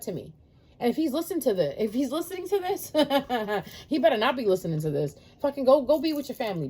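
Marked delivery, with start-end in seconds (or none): 3.0-3.8 short burst of laughter mid-rant; chuckling to herself; amused laugh. Real, not acted. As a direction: laugh at the very idea. Laughs imagining ex listening.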